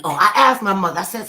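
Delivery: usual speaking voice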